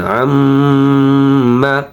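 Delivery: আম্মা with a slow, drawn-out nasal ম; obligatory ghunnah. Shown as a demonstration. Teacher shows ghunnah to learners, আম্মা with long nasal ম. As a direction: hum the doubled ম in আম্মা, held long. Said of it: "এই গুন্নাটা হচ্ছে ওয়াজিব গুন্না".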